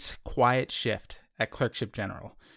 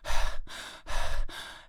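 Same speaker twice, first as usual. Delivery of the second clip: heavy breathless sigh. Acted. Patient struggling to breathe.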